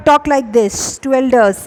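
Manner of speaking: slow, loud, deliberate example; slow model sentence. Shown as a demonstration. Teacher modelling the sentence slowly to students. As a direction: stretch it out for the class. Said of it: "तो इसको कैसे बोलेंगे English में?".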